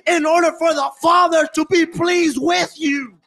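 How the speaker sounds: builds from firm to a high shouted climax; full-force preaching. Acted. Preacher to his congregation about the Father's love, rising to a shout.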